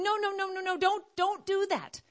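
high, urgent run of no's; desperate pleading. Acted. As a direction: beg them to stop before it's too late. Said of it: "he's just so desperately going".

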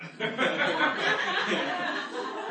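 high, breathy burst of laughter; amused giggling laugh; real laugh. Real, not acted. Laughs at the story.

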